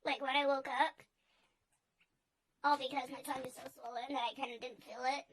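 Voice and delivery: usual speaking voice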